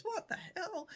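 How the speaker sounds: high-pitched voice of a shocked body; mock shock. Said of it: "your body goes into shock".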